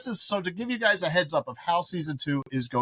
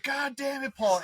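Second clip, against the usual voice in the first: mock exasperated relatives; 10 semitones higher, slower than usual. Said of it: "everybody else is like".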